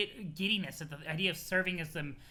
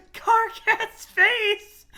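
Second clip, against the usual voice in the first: very high, loud laughing squeal; helpless real laughter; 13 dB louder, much slower than usual. Real, not acted. Reader cracking up at a face, squealing laughter. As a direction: squeal it slowly through laughter.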